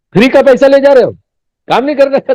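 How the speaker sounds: loud, high, fast mock telling-off; acted scolding. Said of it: "उनको डांटना पड़ता है, जोर से बोलना पड़ता है".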